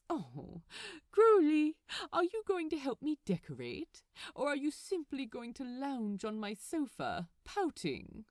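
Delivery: lightly voiced fussy angel, calling his name out bright and loud, then a fast playful teasing; smiling tease at a lounging friend; teasing Aziraphale. A narrator's reading. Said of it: "the corners of his mouth turned up".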